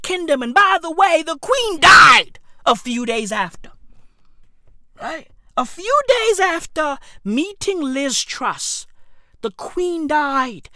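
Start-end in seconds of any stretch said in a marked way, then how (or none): none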